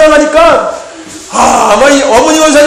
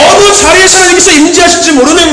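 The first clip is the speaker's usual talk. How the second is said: fiery preaching; 3 semitones higher than usual. Acted. Pastor urging the congregation to stay ready, fiery preaching. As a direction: preach it hard and wait for the amens.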